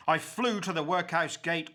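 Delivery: ordinary delivery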